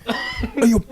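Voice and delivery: very high, squeaked hey yo; mock a tiny voice saying yo; cartoonish falsetto yo; squeaky bro greeting. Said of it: "a really deep Cockney accent".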